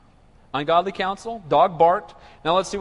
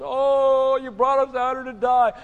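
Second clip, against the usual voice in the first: mock whining; 4 semitones higher, slower than usual. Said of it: "They're gonna be crying in the wilderness".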